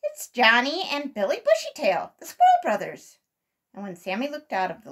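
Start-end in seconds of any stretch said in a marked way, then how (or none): 0.0-3.2 loud, raised-pitch announcement; excited cry. A narrator's reading. Sammy names the squirrel brothers, crying out. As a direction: call out the names in excited recognition. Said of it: "cried Sammy".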